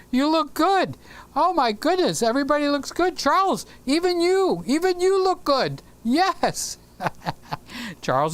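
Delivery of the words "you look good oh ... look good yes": high-pitched gushing compliments; high, teasing amazement at how good everyone looks; playful gushing. Pretend. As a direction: lay on the delighted flattery, pitched way up. Mock delight at viewers' looks.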